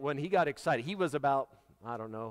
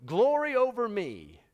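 higher, louder drawn-out cry of praise; excited exclamation; 6 semitones higher, 5 dB louder, slower than usual. Acted. Moses exclaiming to Pharaoh, excited. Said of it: "somebody that was excited about what he just heard".

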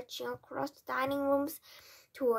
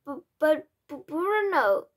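loud, high, shocked stammer; 5 semitones higher, 9 dB louder than usual. A narrator's reading. Father protests to his mouse son, stammering loudly. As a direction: trip over the first word in disbelief. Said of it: "stammered Mister Jenkins".

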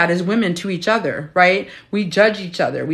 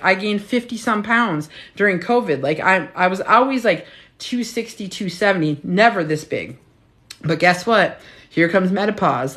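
normal talking voice

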